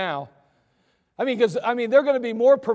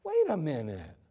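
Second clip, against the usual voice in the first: lower, softer, puzzled wait a minute; scratching his head over Wait a minute; mock puzzled murmur; puzzled townsman; 6 semitones lower, 7 dB quieter than usual. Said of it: "They scratch their heads".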